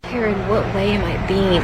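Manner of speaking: ordinary talk, nothing marked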